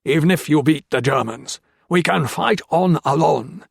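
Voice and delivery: snarled threat. A narrator's reading. Japanese officer snarls threats at the prisoners, loud and hateful. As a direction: snarl it out with contempt. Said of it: "he snarled".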